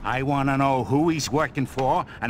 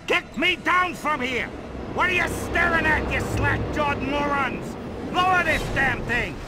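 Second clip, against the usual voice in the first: high-pitched, furious ordering; irate yelling; 5 semitones higher than usual. Acted. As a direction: berate them with the pitch pushed up. Hanging man berates onlookers.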